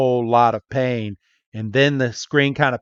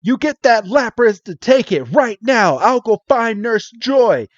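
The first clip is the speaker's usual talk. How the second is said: higher urgent order; Tracy rushing off, higher; hurried, higher; 9 semitones higher than usual. Retold or imitated. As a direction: bark it higher. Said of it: "he's like".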